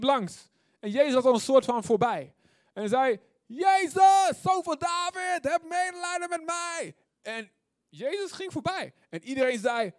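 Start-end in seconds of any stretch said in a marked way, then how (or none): 3.5-6.9 high, loud cry for mercy; calling out. Acted. Blind Bartimaeus crying out to Jesus passing by, high and loud. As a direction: call out after him, pitched way up.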